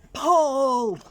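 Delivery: Chameleon shouting the signal to both animals; yell. Acted. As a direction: bellow the one word as the signal. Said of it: "and he yelled".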